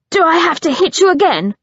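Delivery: quick, high, loud threat; fast threat. Acted. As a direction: rattle off the threat. Threatening with raised fist.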